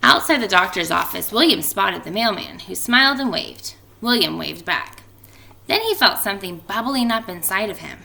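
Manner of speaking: lively storybook reading voice; expressive read-aloud for a class; reading to children. A narrator's reading. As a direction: read it out like story time for kids. Teacher reads picture book aloud.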